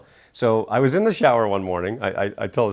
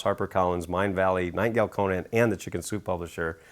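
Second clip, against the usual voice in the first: ordinary talk, nothing marked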